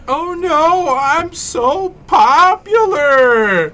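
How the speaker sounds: high, slow, wailing mock despair; mock dramatic wail. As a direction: whine it like a tragic diva. Mock complaint about phone.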